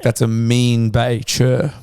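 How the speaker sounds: slow, drawn-out Kiwi slang. Retold or imitated. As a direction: stretch it out like a Kiwi scaffy. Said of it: "I'd be like".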